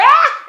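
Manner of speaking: short, high, very loud shriek; reenacted grossed-out scream. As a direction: burst out in a panicked shriek. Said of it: "I'm screaming".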